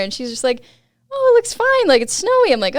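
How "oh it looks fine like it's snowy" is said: imitated friend's high, louder reply; breezy friend. Friend at the bottom reassures rider, breezy imitation. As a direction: voice the friend calling back up, pitched high.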